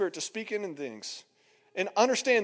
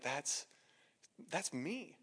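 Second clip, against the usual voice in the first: very soft, halting admission; hushed, with a pause before owning up; crushed realization; 4 semitones lower, 15 dB quieter, much slower than usual. Retold or imitated. Preacher recognizing himself in the words, hushed and halting.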